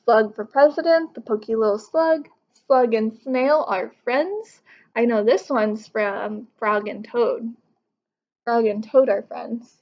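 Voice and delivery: ordinary delivery, nothing marked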